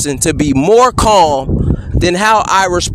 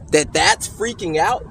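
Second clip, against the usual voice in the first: markedly softer voice; quoting the report; 7 dB quieter than usual. Real, not acted. Man quoting officer's report back to them, softly. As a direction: drop the volume to quote it pointedly.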